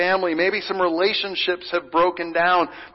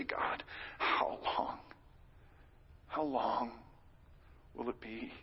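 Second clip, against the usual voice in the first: slow, softer whispered cry to God; whispered lament; 7 semitones lower, 16 dB quieter, slower than usual. Acted. Pastor voicing a grieving heart's prayer, whispered and slow. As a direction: breathe it out like a tired prayer.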